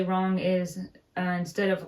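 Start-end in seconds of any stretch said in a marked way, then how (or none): none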